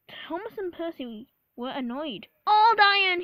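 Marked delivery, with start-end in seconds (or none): 2.5-3.2 loud, high scornful jeer; snorting. A narrator's reading. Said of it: "he snorted".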